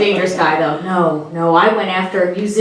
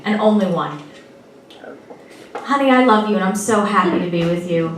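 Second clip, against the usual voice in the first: ordinary talk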